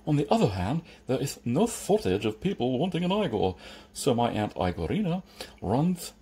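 lisping servant with lifted pitch; Igor. Acted. Igor telling Jeremy about his aunt's agency, lisping. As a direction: carry on smoothly through the lisp.

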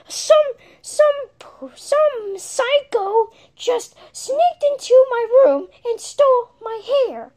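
high whiny fake sob; mock crying. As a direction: whimper it like a wronged kid. Upset voice over stolen wig.

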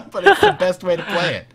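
laughing through exasperated words; real laughter. Real, not acted. Player grumbling about the frozen program, laughing. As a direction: break into high laughter as you complain.

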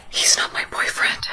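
hushed, voiceless whisper; secret whisper. Acted. Secret plea to saleswoman.

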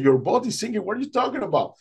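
high incredulous questioning; retold disbelief. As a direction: react aghast to alarming news. Swimmer reacts in disbelief.

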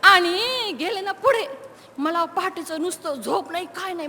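normal talking voice

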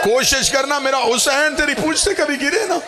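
high, sustained, drawn-out lament; impassioned lament preaching. Acted. Majlis speaker telling mourners of Zahra's plea, impassioned lament. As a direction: preach it at full force, pitched high and stretched.

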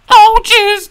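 sudden loud panicky oh jeez; nervous yelp. Acted. Mario startled by the idea of failing, nervous yelp. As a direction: jolt of nerves. Fearful reaction to bad outcome.

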